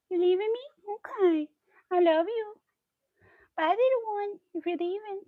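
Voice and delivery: high-pitched pet talk; lifted, doting cat-owner voice. Real, not acted. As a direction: coo to a cat that's wandering off. Cooing to the departing cat.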